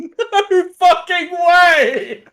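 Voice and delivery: laughing through the words; cracking up in disbelief. Real, not acted. Repeated while laughing.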